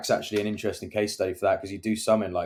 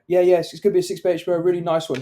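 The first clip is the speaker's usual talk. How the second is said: fast, higher, mock-cheery owner voice; eager owner; 9 semitones higher, 5 dB louder, faster than usual. Retelling the owner answering the angry neighbour, fast and mock-cheery. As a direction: rattle it off like a keen salesman. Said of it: "And then he went".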